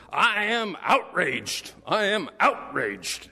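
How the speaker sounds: high, loud, slow outraged declaration; blasting outraged activist. Retold or imitated. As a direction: belt it out like an indignant protester. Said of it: "she had one of these blasting voices".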